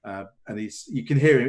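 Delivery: ordinary talk, nothing marked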